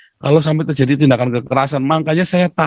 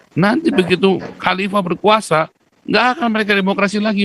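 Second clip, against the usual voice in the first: usual speaking voice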